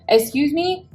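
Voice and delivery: high, sharp disbelief; outraged at what she just heard; indignant excuse me. Real, not acted. Telling viewers how the hospital treated her, indignant. Outraged at being told to pay collections.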